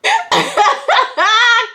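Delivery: high loud burst of laughter. Real, not acted. Laughing at her own wife skit, loud.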